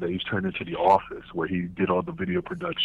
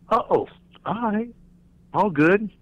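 mock hurt; 10 semitones higher than usual. Mock reply when losing ride.

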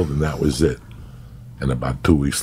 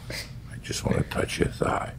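whispered slowly, barely voiced; nervous whisper; 9 dB quieter than usual. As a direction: breathe it out like a scared kid. Nervous teen asking softly.

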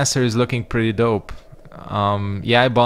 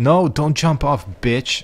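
higher-pitched startled outcry; alarmed protest; 6 semitones higher than usual. Real, not acted. Player reacting to a jump off the ledge, alarmed. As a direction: blurt it out in sudden alarm.

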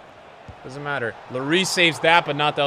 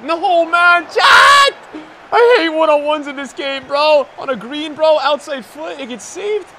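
very high furious shouting; raging gamer; 13 semitones higher, 9 dB louder than usual. Real, not acted. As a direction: scream it out in rage. Raging at missed chance.

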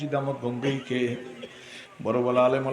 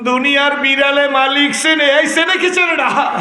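melodic waz preaching; 13 semitones higher, 9 dB louder than usual. Acted. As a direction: preach in a sustained singing cry, stretching the phrase endings. (সুর করে উচ্চস্বরে ওয়াজ)

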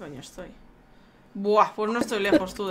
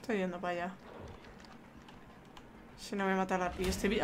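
normal talking voice

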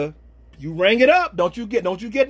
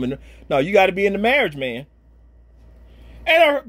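normal talking voice

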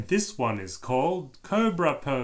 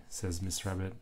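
usual speaking voice

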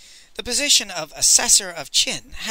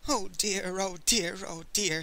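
whining; 3 semitones higher, 4 dB quieter than usual. A narrator's reading. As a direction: whine it like a nervous little man with a heavy burden. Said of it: "he whined".

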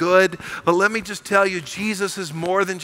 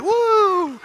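joyful whoop; 13 semitones higher than usual. Pretend. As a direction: whoop like a kid riding a lion. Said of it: "And you're like".

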